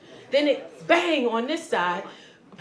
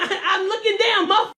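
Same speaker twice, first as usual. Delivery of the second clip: high-pitched comic fury; exasperated retort; 7 semitones higher, 3 dB louder, faster than usual. Acted. Trapped driver answers rescuer's order to look, exasperated retort. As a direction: fire back, hammed-up and indignant.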